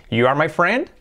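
statement "You are my friend" turned up at the end; wrong question tune. Shown as a demonstration. Teacher showing what fails in English, rise on "friend". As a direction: make it a question only by raising "friend". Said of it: "no sirve como en español de decir".

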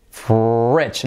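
"fridge" drawn out as "fredge", R held. Shown as a demonstration. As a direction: stretch the R in "fridge" and use an E vowel. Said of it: "Letra clave I como E".

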